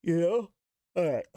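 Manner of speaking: words through a yawn. Real, not acted. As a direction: talk mid-yawn. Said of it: "Sorry for yawning".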